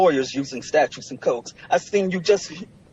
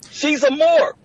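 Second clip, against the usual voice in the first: heated declaration; 10 semitones higher, 4 dB louder, slower than usual. Real, not acted. Litigant defending an absent woman to the judge, heated. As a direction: pitch jumps high in protest.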